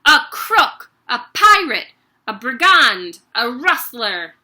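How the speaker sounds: raging headmistress yell; screaming accusations. Acted.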